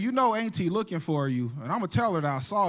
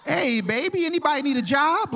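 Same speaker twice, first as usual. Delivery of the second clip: higher-pitched smooth-talking pimp pitch; Silky the pimp; 6 semitones higher, 3 dB louder than usual. Acted. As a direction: play a pimp dad touting for recruits. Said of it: "Here's my dad, Silky".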